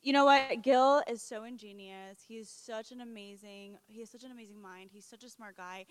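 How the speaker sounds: normal talking voice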